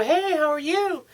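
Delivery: high, mock girly greeting; woman's voice. Man imitating the woman replying at the bar, high and girly. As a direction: lightly imitate a woman saying hi back. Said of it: "And she'll go".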